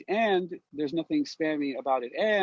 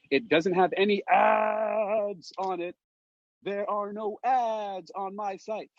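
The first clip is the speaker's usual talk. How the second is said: slow, high, emphatic boast; 8 semitones higher, much slower than usual. Real, not acted. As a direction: drive home there are no ads. Boasting no ads on site.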